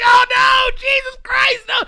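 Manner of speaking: high, loud hammed-up panic shriek; mock terror. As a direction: scream like you've been caught. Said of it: "I'm like".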